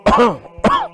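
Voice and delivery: rough repeated choking cough. Real, not acted. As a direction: hack it out like you are choking. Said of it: "while I choke".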